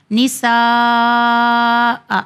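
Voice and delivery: "نساء" with a very long held aa, clipped stop; pausing recitation. Shown as a demonstration. Teacher showing how to stop, very long held vowel. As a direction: stretch "نساء" far out, then stop on hamza. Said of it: "اس طرح سے اسے ادا کرنا ہے".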